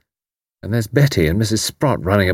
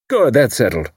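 good, that's settled; 3 semitones higher than usual. A narrator's reading. Haydock voiced.

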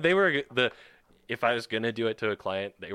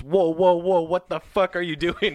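alarmed shout at another driver; startled road rage yell; 8 semitones higher, 7 dB louder, slower than usual. Retold or imitated. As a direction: shout in startled anger at a car. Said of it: "some guy turned in front of me".

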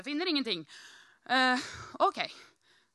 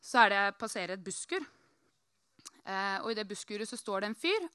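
usual speaking voice

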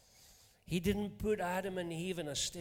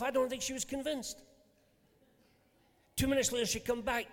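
usual speaking voice

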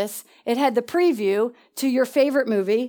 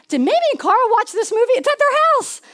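much higher, urgent excitement; excited high pitch; 9 semitones higher than usual. Real, not acted. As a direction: race up in pitch with the discovery. Excited realisation.

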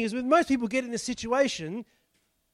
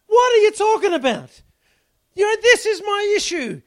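frustrated outburst; 9 semitones higher, 9 dB louder than usual. Retold or imitated. Mock frustration with God's answer.

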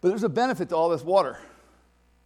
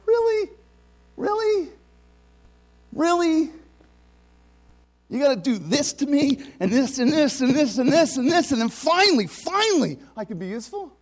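much higher, louder, incredulous complaint; exasperated disbelief; 7 semitones higher, 4 dB louder than usual. Retold or imitated. Preacher retelling his complaint to Jesus, high exasperated disbelief. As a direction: protest to heaven in mock outrage, voice climbing on each Really. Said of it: "what I said to Jesus".

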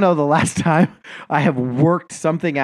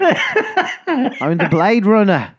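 put-on slow, higher Australian accent, laughing through it; Gary Oldman hamming an Australian accent; drawn-out mock Australian accent; 9 semitones higher, 3 dB louder, much slower than usual. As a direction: stretch it out in a hammy Australian accent. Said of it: "working your way through a South African accent".

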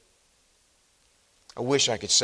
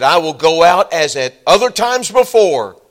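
louder, boastful declaration; confident Samson; 5 semitones higher, 13 dB louder than usual. Retold or imitated. Samson waking, loud and confident. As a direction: declare it boldly. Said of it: "he said".

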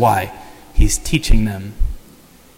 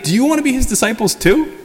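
much higher, sarcastic taunting question rising at the end; "Do you wanna be his disciples too?" sung out high as a jeer; mocking; 10 semitones higher than usual. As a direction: needle them with a high mock question. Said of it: "He's mocking them".

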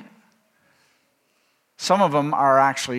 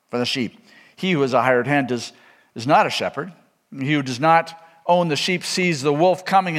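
raised, declaiming Bible reading, a little higher; scripture reading; 4 semitones higher, 3 dB louder than usual. Acted. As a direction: proclaim the passage to the congregation. Continues reading John ten.

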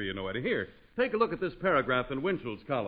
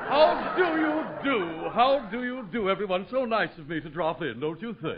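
gone-Hollywood grandeur; 4 semitones higher than usual. Acted. Announcer greeting everyone on arrival, grand Hollywood affectation. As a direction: sweep in like a self-important film star. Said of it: "Von Zell certainly has gone Hollywood".

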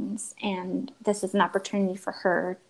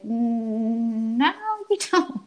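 playful denial; 3 semitones higher, slower than usual. Retold or imitated. Teasing son about brother.

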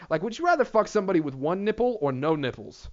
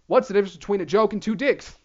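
normal talking voice

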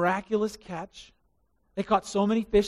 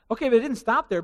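normal talking voice

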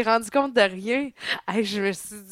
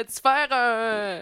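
normal talking voice

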